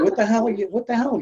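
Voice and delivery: chewing out the late friend, high and incredulous; exasperated outburst. Retold or imitated. To a friend who showed up late, a high exasperated outburst.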